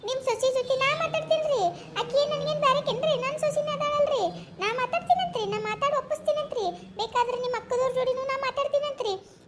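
high-pitched, insistent pleading; acted, high-voiced coaxing; urgent persuasion. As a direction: press him eagerly, voice lifted high. Pressing him to agree to marriage.

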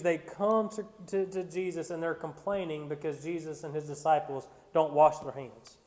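normal talking voice

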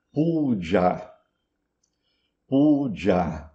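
"puja" as poo-JAA, both vowels long; Sanskrit long A. Shown as a demonstration. Teacher demonstrates the Sanskrit word, long ending on "puja". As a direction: hold the final A of "puja". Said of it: "em sânscrito".

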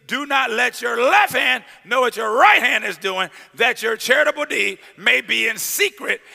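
preaching scripture. Acted.

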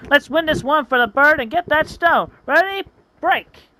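high, pumped-up team call; rallying huddle cry. Acted. Sanchu breaking the huddle before the fight, high and rallying. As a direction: fire the team up. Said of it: "calls a timeout and a huddle".

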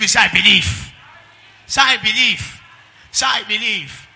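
loud call to the congregation; rally the church, voice ringing; fiery sermon call and response; preaching at full force. Real, not acted.

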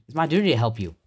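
ordinary talk

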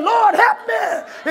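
high, drawn-out plea, preached; crying out to God. Retold or imitated. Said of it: "you cried and said".

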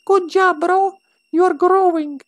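very high cartoonish falsetto; squeaky put-on voice. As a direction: whine it in a squeaky little voice. Keeps up the squeaky voice.

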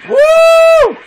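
very high, loud whoop; thrilled high yell; joyful scream. Real, not acted. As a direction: scream with glee in the air. Screaming on a big jump.

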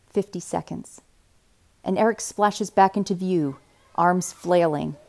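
ordinary delivery

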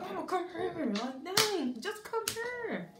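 high, drawn-out whine at mom; exasperated childish plea to mama; whiny pleading. Retold or imitated. Begging mom to come closer.

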